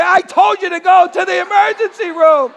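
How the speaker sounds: high, loud mock-angry shout; scolding doctor. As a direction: imitate a doctor yelling I-told-you-so.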